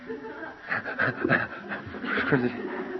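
soft, mostly voiceless chuckling; real wheezy laugh. Real, not acted. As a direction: laugh along quietly, running out of voice. Laughs at everybody rushing there.

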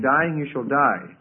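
slow, weighty stress on "Dying"; solemn emphatic quote; emphatic scripture line. Shown as a demonstration. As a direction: draw out "Dying, you shall die" with solemn weight. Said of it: "It's very emphatic".

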